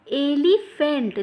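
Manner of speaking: एलिफेंट drawn out slowly for students; slow model word. Shown as a demonstration. Teacher modelling एलिफेंट slowly for her students. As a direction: spell out एलिफेंट slowly and loudly. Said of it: "an एलिफेंट यानी कि".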